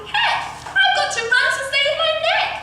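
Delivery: very high panicky shout; high-pitched, urgent holler. A narrator's reading. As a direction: yell it high in a great hurry to go. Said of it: "she shouted".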